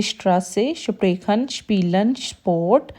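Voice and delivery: sh before t and p in स्प्रेखन, स्पीलन. Shown as a demonstration. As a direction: say स्ट्रासे as "shtrasse", स्पोर्ट as "shport". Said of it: "ये शीन की sound". (स्ट्रासे, स्पीलन में स की जगह शीन की sound)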